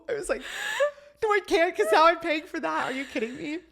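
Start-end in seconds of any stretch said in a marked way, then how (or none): 0.5-1.0 sharp high gasp with a laugh; shocked gasp. Retold or imitated. Shocked by the boot price. 1.2-3.6 high, loud indignant protest; outraged disbelief. Retold or imitated. Retorting to old man.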